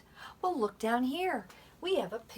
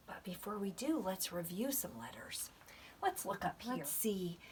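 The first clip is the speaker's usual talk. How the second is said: whisper; 10 dB quieter than usual. Shown as a demonstration. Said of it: "teacher whispers to class".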